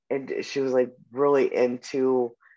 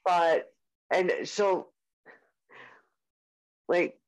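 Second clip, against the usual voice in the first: ordinary delivery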